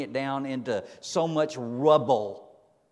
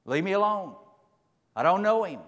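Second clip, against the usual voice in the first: loud and higher, pushing them away; cornered man yelling them away; frantic denial; 8 semitones higher, 6 dB louder than usual. Acted. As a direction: shout it off, cornered. Peter's frantic denial continues.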